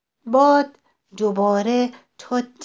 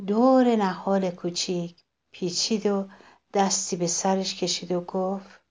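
plain reading voice

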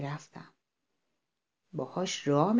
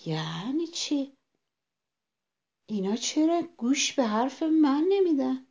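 higher-pitched puzzled self-talk; bewildered; 6 semitones higher than usual. A narrator's reading. Ignored rabbit asking itself why, bewildered. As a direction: wonder aloud, pitch climbing. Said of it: "خرگوش هاج و واج مونده بود".

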